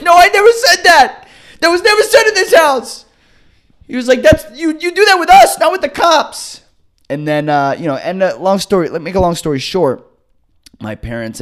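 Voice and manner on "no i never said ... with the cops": much higher and louder, furious father; hammed-up yelling dad; mock outraged dad shout.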